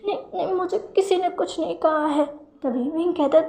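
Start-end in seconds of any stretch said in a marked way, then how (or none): 0.1-2.2 put-on a young man's tearful denial, hiccuping; crying with hiccups. A narrator's reading. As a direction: choke the words out between hiccuping sobs. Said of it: "रोते हुए हिचकियाँ लेते हुए कहता है".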